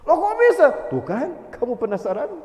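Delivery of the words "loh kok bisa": loud, high "how come?"; surprised exclaim. Real, not acted. Preacher to congregation, loud surprised exclaim. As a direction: burst out in disbelief.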